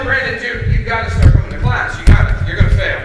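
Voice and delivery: loud, insistent teacher's urging; urgent warning. Retold or imitated. Teacher warning Brandon he'll fail, loud and urgent. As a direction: press it hard, punching the words. Urging a failing student to attend.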